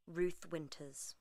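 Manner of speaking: ordinary delivery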